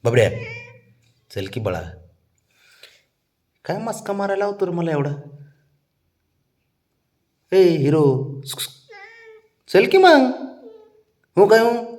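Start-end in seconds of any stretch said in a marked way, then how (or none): 3.7-5.1 much higher, teasing sing-song; sing-song scolding. Real, not acted. Coaxing a pet to get up. 7.5-11.9 much higher and louder coaxing call. Real, not acted. Coaxing a pet to get up.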